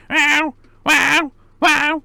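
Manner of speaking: high, loud imitated cat meow; high loud cat meow imitation. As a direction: copy the cat's nagging meow. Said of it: "the exact thing like your cat does".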